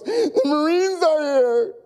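high, slow, crying relief; acted cry that help has come; overjoyed relief. Trapped fireman told Marines arrived, overjoyed cry.

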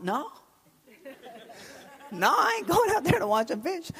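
high, laughing "No, I ain't going out there". Retold or imitated. Preacher laughing at the idea of watching fishing. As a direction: scoff at the idea through a laugh. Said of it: "And I thought".

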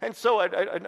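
ordinary talk

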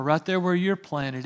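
ordinary talk

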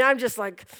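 ordinary talk, nothing marked

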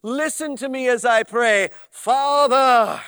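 swelling into a grand prayer voice; mock pompous prayer. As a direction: lampoon the self-important pray-er. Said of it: "the voice changes".

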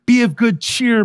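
loud, high, steadfast encouragement. A narrator's reading. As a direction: call out firm encouragement to a struggling friend. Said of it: "responds steadfastly".

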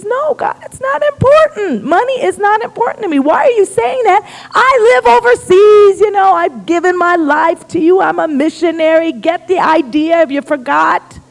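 high, insistent arguing with mock offense; indignant protest. Missionary reminding God of his sacrifices, indignant protest. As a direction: plead your case, voice pitched right up. [name] argues back at God.

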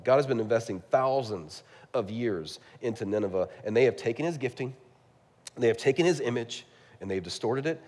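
ordinary talk, nothing marked

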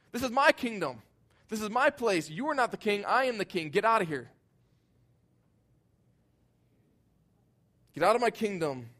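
high, loud shout of a king defending his turf; angry shout. Retold or imitated. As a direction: bark them out of your kingdom. Acting the self-reigning king.

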